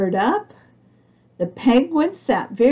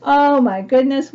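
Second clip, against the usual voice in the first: 1 mock surprised gasp; 4 semitones higher, 5 dB louder than usual. Mock gasp then exclaim.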